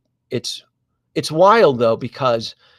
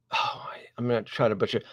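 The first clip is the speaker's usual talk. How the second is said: whispered aside; 3 semitones lower, 5 dB quieter, faster than usual. Real, not acted. Aside to himself mid-story.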